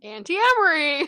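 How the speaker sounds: high, loud gleeful outburst; excited exclamation. Real, not acted. Co-host jumping in about who left the key, excited exclamation. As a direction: sing out the aunt's name, high and loud.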